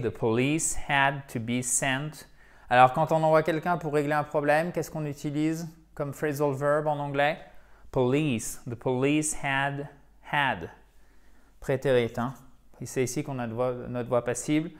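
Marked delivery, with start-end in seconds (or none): 0.0-2.2 "the police had to be sent" read slowly; slow model sentence. Shown as a demonstration. 7.9-10.7 "the police had" read slowly; slow model reading. Shown as a demonstration. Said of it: "model sentence read slowly".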